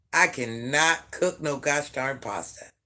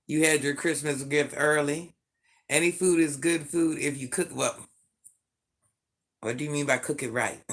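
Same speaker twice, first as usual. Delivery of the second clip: ordinary talk, nothing marked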